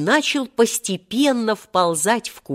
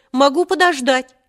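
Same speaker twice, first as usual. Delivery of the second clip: higher, plucky answer; brave reply; 7 semitones higher, 4 dB louder than usual. A narrator's reading. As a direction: toss it back bravely. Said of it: "ответил мужественно Серёжа".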